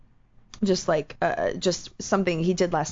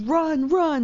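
terrified; 9 semitones higher than usual. Retold or imitated. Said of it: "I was so terrified".

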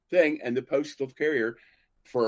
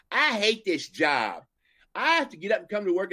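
whiny clerk; 9 semitones higher, 4 dB louder than usual. Retold or imitated. Teller mocks postal girl hating her job, higher. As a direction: whine like a bored postal worker. Post office girl voiced.

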